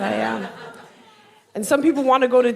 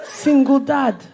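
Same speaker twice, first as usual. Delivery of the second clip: put-on London accent, pitched up; guessed London accent; 7 semitones higher than usual. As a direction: read it out proudly in a broad London accent. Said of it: "guess what his accent is".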